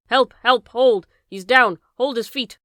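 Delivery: loud frantic shouts; screaming. A narrator's reading. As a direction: yell for help at full volume. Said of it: "screamed Kemp".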